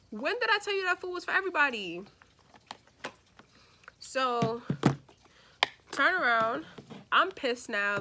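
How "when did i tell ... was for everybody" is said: quick, higher, exasperated demand; indignant retort. Retold or imitated. To the cousin who ate her chicken, a quick exasperated retort. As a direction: fire it back at the cousin like he's lost his mind. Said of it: "I said".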